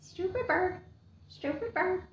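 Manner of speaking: high squawking parrot. Acted. Bird parrots insult.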